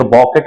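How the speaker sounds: बॉकेट with an 'aw' vowel and final t; wrong bouquet pronunciation. Shown as a demonstration. Teacher shows students what to avoid, बॉकेट with 'aw' and t. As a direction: misread it as बॉकेट. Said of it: "मत पढ़िएगा".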